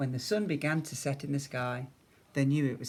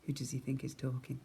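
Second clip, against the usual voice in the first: ordinary delivery, nothing marked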